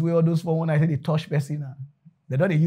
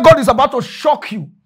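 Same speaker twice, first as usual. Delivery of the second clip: loud, high preacher voice; prophetic proclamation; 5 semitones higher, 6 dB louder than usual. Acted. Pastor prophesies to young man, loud proclamation.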